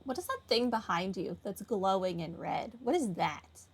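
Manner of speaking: ordinary talk, nothing marked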